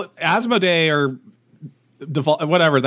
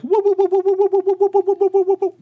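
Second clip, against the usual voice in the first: psychic noise demo. Shown as a demonstration. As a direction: show him how a psychic really hums. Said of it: "it's more like".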